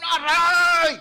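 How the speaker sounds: loud, high, half-laughing "aray!"; laughing ouch; mock yelp of pain. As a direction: yelp like your foot was stepped on. Stepped on by a heel.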